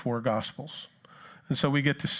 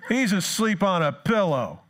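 slow, higher mocking emphasis; sarcastic; 6 semitones higher, 4 dB louder than usual. Preacher mocking Jesus sleeping, slow sarcastic stress. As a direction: sneer at the pillow. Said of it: "I'm sarcastic from Jersey".

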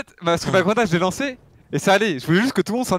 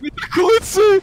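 exasperated protest; 12 semitones higher, 5 dB louder than usual. Real, not acted. Arguing over grenade.

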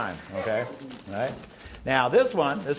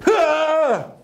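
magician's exclamation; 12 semitones higher, 6 dB louder than usual. Pretend.

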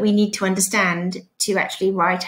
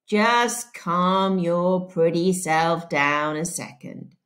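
very slow, lazy sheriff drawl; 3 semitones lower, slower than usual. Acted. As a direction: stretch every word like a lazy sheriff. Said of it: "in a slow drawl".

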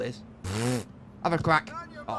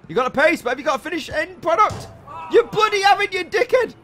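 angry shout; 12 semitones higher, 6 dB louder than usual. Real, not acted. Ranting at striker.

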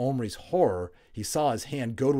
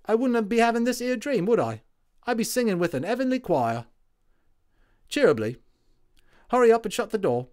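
higher, louder cockney soldier; cheery London Tommy; 12 semitones higher, 9 dB louder than usual. A narrator's reading. Tommy voiced.

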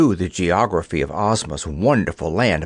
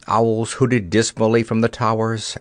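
ordinary delivery

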